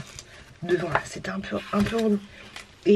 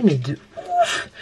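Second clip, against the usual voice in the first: high, slow squeaked exclamation; a sudden high squeal of regret; squeaky de ouf; much slower than usual. Real, not acted. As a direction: squeak it out in dismay.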